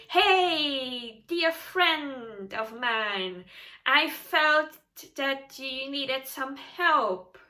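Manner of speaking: slow, syrupy mock friendliness with a laugh; butter them up, slowly and falsely; fake sweet. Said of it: "and go".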